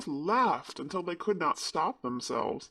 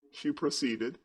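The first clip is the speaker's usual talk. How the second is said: plain reading voice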